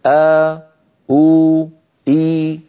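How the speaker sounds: three separate drawn-out vowels آ، أو، إي; sustained long vowels. Shown as a demonstration. Teacher giving students three sounds to memorise, each held long. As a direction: hold آ, أو, إي as long aa, uu, ii. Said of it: "واحفظوا هذه الأصوات الثلاثة".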